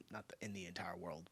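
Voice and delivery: a breathy whisper tucked under her breath; whispered aside. Real, not acted. Correcting her own exaggeration to the viewers in a whisper.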